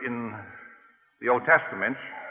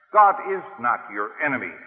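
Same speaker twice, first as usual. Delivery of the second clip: ordinary talk, nothing marked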